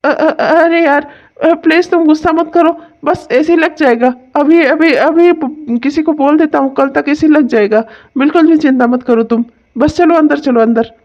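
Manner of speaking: high, nervous pleading; placating husband. Acted. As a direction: soothe his angry wife, pitch up. Husband placates angry wife.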